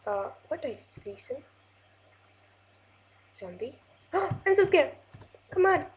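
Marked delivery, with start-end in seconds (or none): 4.1-5.8 higher, half-laughing scared cry; mock panic at the noise; playful fright. Real, not acted. Gamer hearing a zombie nearby, playfully frightened.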